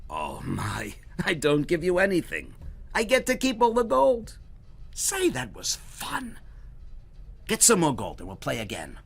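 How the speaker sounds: high, loud mock-innocent triumph; gloating Herschel. Herschel tells the goblin he keeps all the gold, gloating. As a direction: pretend surprise, then gloat.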